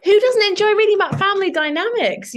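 high, loud rhetorical gush; excited. Real, not acted. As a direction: exclaim it as if it's obvious to everyone. Gushing answer to host's question.

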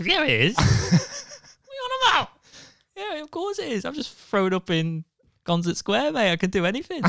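hammed-up cocky drunk insisting; squeaky mock drunk swagger, laughing; drunk cocky self. Retold drunk defiant self.

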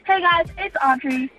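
ordinary delivery

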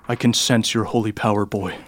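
lightly voiced villain, dropping low on the last word; imposing interrogator. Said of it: "and he goes".

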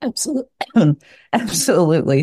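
hoarse, fading voice after clearing the throat; husky, strained voice that's giving out; losing voice. Real, not acted. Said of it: "Sorry, I'm losing my voice a little bit".

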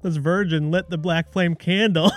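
loud, hammed-up movie cop line; Hocus Pocus cop. Imagining the border cop as the fake Hocus Pocus cop, hammed-up. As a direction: ham up the fake Halloween cop. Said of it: "the fake cop from Hocus Pocus".

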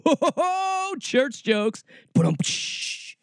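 ordinary talk

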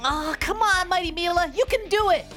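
high, pleading cheer; root hard for a struggling champion; anxious fan's high-pitched encouragement; urging on. Acted. Kid urges on struggling hero.

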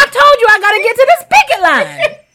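high, loud, indignant retort; hammed-up fed-up protest; retold exasperation. Late for the picket line, loudly exasperated with a stranger.

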